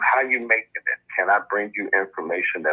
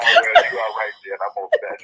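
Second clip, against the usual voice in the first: laughing; 2 semitones higher, 4 dB louder than usual. Real, not acted. Guest laughs at the reply.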